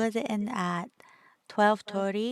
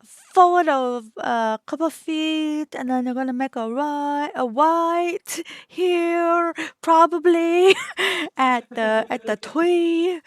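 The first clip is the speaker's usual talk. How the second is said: hammed-up fake accent, higher and louder, W for R; 5 semitones higher, 5 dB louder than usual.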